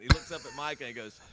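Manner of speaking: usual speaking voice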